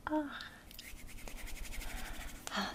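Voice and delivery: ordinary talk, nothing marked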